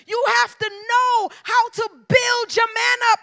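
loud, rising preacher cadence to the church; full preaching. Acted. Preacher exhorting wives in the congregation, loud and driving. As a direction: preach it hard, calling the women to build their men.

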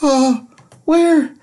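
put-on scared children hesitating; timid kids asking. Frightened kids ask the new teacher where their teacher went, very high and timid. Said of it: "asked the kids".

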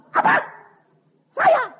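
very high, loud disbelief; acted indignant shock. Husband hears that he is to blame, indignant shock. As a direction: shoot up in mock outrage.

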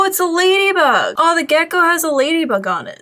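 ordinary talk

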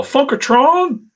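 drawn-out, high-pitched yell; big high greeting yell across a convention. Retold or imitated. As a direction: holler it loud, high and stretched out. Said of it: "I'll yell".

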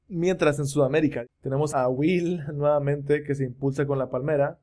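ordinary delivery

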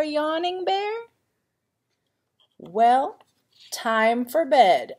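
ordinary delivery, nothing marked

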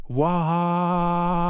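loud, high, short mock baby wail; fake-cry like a punished kid; pretend wail. Grounded [name] wails a high mock cry at his parent.

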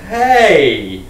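loud, drawn-out, unsure greeting; acted uncertain greeting. Answering a caller whose voice is unfamiliar, acted uncertainty. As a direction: stall with a hesitant, stretched hello. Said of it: "you have that weird moment where".

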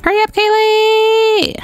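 high, drawn-out holler; sing-out hurry-up call; urgent calling out. Pretend. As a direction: call out in a high stretched pitch. Urging the sim on.

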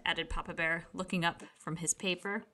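ordinary delivery

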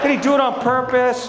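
put-on high, earnest kid question; a son asking earnestly about the driver; innocent child. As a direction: ask it with wide-eyed innocence. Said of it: "He's just like".

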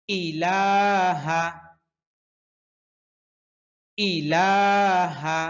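ইলাহা with a crisp, distinct opening ই; slowly, hamza clearly voiced. Shown as a demonstration. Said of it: "স্পষ্ট করে উচ্চারণ করতে হবে".

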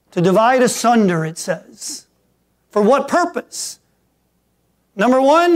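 high, loud, driving preacher voice; full-force preaching. Acted. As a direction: build the sermon to a roaring peak. Preaching climax.

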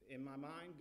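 usual speaking voice

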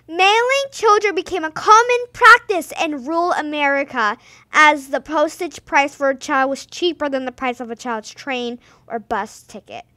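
ordinary delivery

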